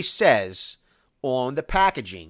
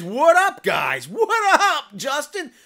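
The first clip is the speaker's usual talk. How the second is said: much higher, louder shouted hello with a laugh; hyped greeting; 8 dB louder, much slower than usual. Acted. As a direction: shout the welcome like hyping up the crowd. Reading a chat greeting.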